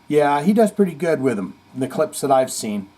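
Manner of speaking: ordinary delivery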